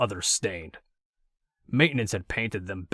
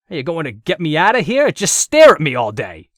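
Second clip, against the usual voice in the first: loud, higher irritated snapping; angry voice; 7 semitones higher, 13 dB louder than usual. A narrator's reading. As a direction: demand it impatiently, fed up. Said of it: "the head said with an angry voice".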